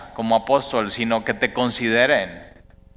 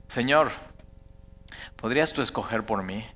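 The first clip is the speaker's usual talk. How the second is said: softer, lower, humble plea to God; soft prayer; 4 semitones lower, 7 dB quieter than usual. Shown as a demonstration. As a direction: pray it gently as your own request. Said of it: "aprendas a decir eso".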